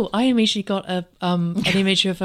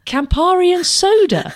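high, loud, drawn-out disbelief; incredulous; 9 semitones higher, 6 dB louder, slower than usual. Retold or imitated. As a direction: quote her as if the drink is outrageous. Quoting a character's disbelief at the drink.

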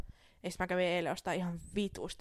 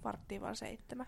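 whisper; 13 dB quieter than usual. Real, not acted. Mock whisper of early hour.

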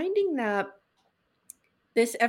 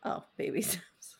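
laughing whisper; 3 semitones lower, 8 dB quieter than usual. Real, not acted.

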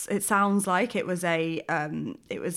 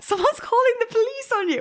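much higher, laughing incredulous husband; retold disbelief; 4 dB louder than usual. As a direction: play the stunned husband. Husband's baffled reply retold.

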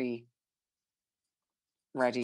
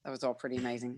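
usual speaking voice

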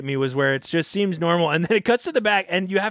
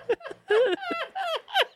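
high, eerie clown cackle; creepy cackle; 5 dB quieter than usual. Pretend. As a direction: cackle like a crazy old grandma. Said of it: "That was so creepy".

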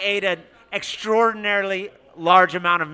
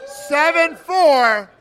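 raffle announcer; 3 semitones higher, much slower than usual. Real, not acted. Calling raffle numbers slowly.

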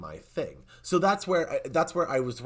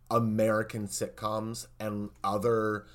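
ordinary delivery

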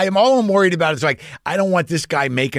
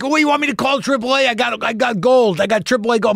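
eager stranger; 8 semitones higher than usual. Retold or imitated. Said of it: "He's like".

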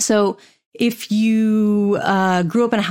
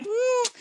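high-pitched, soft "mm"; mock skeptical squeal of a hum; doubtful hum; 6 dB quieter than usual. Imitating a customer's doubt, high soft skeptical "mm". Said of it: "That weird inflection on the end".

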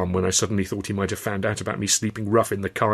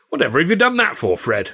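a hint of a casual quip, higher; mildly voiced bystander's question; lightly voiced bemused comedian; 6 semitones higher, 3 dB louder than usual. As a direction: ask it offhand while munching. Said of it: "said George Roby".

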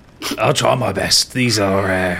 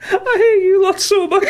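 laughing; 5 dB louder than usual. Real, not acted. Table in fits of laughter.

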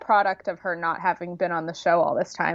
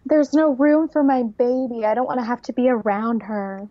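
mock complaint; 4 semitones higher than usual. Podcaster imitating a cast member who acts tough, mock complaint. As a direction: imitate someone complaining in a raised voice. Mimicking Kale's complaint about baby.

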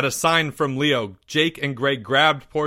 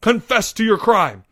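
raised, loud commanding shout; thundered; 8 semitones higher, 5 dB louder than usual. Acted. Leo demanding Porge's confession, thundered order. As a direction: demand it at full voice. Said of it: "he thundered".

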